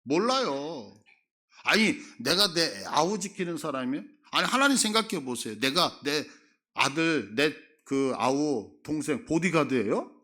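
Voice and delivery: loud, indignant Cain brushing off God; brazen denial. Retold or imitated. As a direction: Cain talking back to God, shamelessly indignant. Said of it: "이런 뉘앙스거든요".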